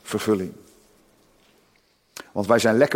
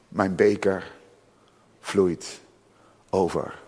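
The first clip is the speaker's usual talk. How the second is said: soft, lower, lingering psalm words; softly quoted psalm; 4 semitones lower, 3 dB quieter, slower than usual. Acted. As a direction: savour each word of the psalm. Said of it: "David zegt". (zacht en verstild citaat)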